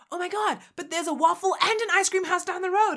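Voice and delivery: high-pitched excited outburst; mock-excited, high-pitched exclamation; retold excitement. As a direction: gush as if torn between two treats. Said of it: "we would be like".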